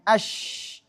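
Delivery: "Ash" with a long sh; sustained hushing end on "Ash"; flowing shin. Shown as a demonstration. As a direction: let the sh of "Ash" stream out. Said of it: "Kemudian shin".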